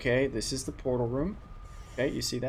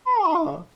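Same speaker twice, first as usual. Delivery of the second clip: piercing high bird screech; bird call; 11 dB louder than usual. Shown as a demonstration. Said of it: "if I keep making that noise".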